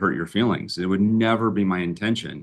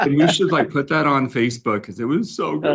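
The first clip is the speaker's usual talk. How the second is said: higher, louder gushing praise; impressed delight; 6 semitones higher, 4 dB louder than usual. Retold or imitated. Wife mid-cry praising husband's apology, gushing. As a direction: gush like she just saw a great take. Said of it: "she goes".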